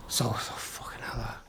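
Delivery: hushed, slow whisper of dread; a low whispered oh no; whispered curse. Retold or imitated. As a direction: breathe it out under your breath. Quiet aside at arrest.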